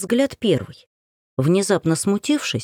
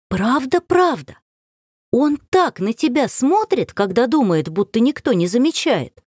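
put-on eager young girl; 6 semitones higher than usual. A narrator's reading. Varenka insists eagerly.

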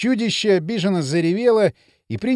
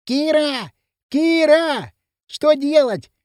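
high, urgent calling from afar; high-pitched distant shout for help; desperate cry; 9 semitones higher, 4 dB louder, much slower than usual. A narrator's reading. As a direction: call out desperately, pitch well up. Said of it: "прилетел отчаянный крик".

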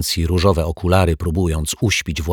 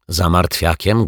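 ordinary delivery

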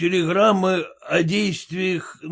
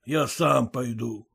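plain reading voice

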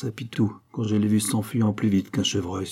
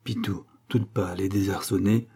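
usual speaking voice

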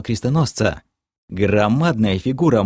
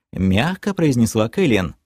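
plain reading voice

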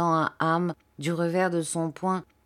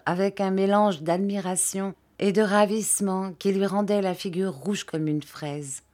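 ordinary delivery, nothing marked

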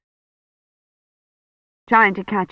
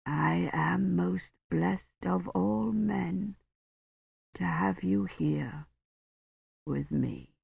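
slow, much softer, thick-tongued words; blurred dying voice; 12 dB quieter, slower than usual. A narrator's reading. Dying Roche speaks to Kivrin, slow, soft and blurred. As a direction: speak through a swollen tongue.

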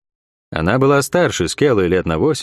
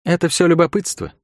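casual throwaway, a bit higher; offhand; 7 semitones higher than usual. A narrator's reading. Southern woman dismissing the dead servant, offhand. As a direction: toss it off without turning. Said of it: "небрежно бросила".

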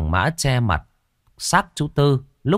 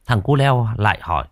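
ordinary delivery

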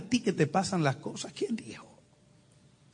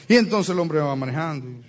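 ordinary delivery

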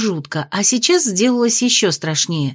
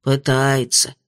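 sighing; 8 semitones lower, slower than usual. A narrator's reading. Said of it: "вздохнул хранитель".

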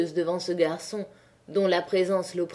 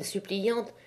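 plain reading voice

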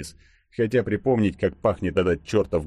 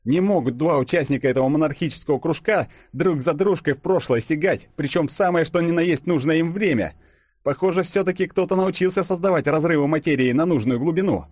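put-on excited young man; 10 semitones higher, 3 dB louder than usual. A narrator's reading. Fadeev voiced, eager theory.

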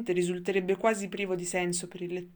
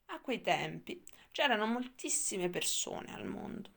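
plain reading voice

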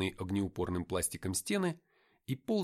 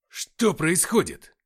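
alarmed call; 7 semitones higher, 11 dB louder, much slower than usual. A narrator's reading. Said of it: "спросил Артём".

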